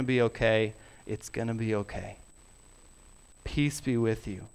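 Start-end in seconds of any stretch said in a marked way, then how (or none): 1.1-2.2 soft reassurance. Real, not acted. Preacher's reassurance. 3.4-4.5 gentle blessing. Real, not acted. Blessing the congregation.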